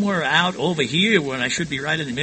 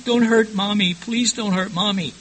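higher-pitched imitation of frightened children; pleading little girls; 7 semitones higher than usual. Little girls begging their father from the corner, high pleading. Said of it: "two little girls are over here in the corner saying".

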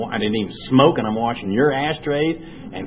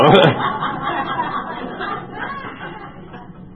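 big laugh. Real, not acted. Speaker bursts out laughing at book.

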